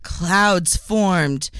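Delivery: ordinary talk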